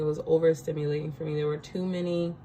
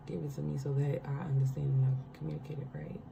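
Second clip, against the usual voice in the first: real whisper, asking the Lord under her breath; a real hushed plea for the right words; whispered prayer; 5 dB quieter, faster than usual. Real, not acted. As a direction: murmur a quick prayer to herself, unvoiced.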